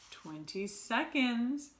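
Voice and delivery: slow, drawn-out witch cry; witch counting down. Acted. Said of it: "cried the Grand High Witch".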